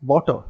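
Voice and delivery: "Water" with regional-accent sounds. Shown as a demonstration. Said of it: "that's how it would sound".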